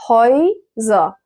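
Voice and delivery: Häuser slowly, split into two syllables. Shown as a demonstration. Teacher showing learners a new word, slow split Häuser. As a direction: break Häuser apart with a gap after "hoy".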